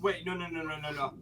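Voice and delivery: ordinary delivery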